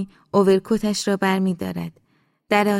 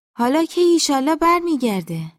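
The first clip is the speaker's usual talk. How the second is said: mother's voice; 8 semitones higher, 5 dB louder than usual. Acted. Mother asks daughter about his return, high and loud. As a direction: ask in a raised, motherly character voice.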